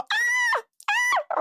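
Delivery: very high-pitched squealed "ah"; imitated action yell. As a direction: squeal like an action heroine with a gun. Imitates film's gun fight.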